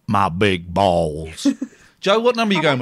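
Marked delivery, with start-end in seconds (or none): 0.1-1.5 mock low voice. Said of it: "repeats the punchline slowly".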